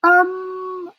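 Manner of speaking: high, drawn-out um; awkward hesitation. A narrator's reading. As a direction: hesitantly get someone's attention. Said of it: "I clear my throat".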